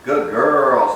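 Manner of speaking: ordinary talk, nothing marked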